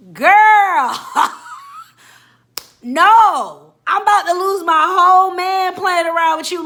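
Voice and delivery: loud, high, disbelieving shout; exasperated yell. Real, not acted. To the meddling friend, loud exasperated shout. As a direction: blow up at her, loud.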